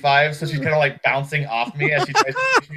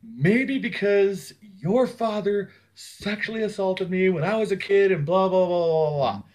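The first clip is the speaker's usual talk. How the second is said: slow, pitched-up outburst, half-acted; erupting confession; 5 semitones higher than usual. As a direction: spill it out like a volcano, slow and higher. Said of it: "came out like a freaking volcano".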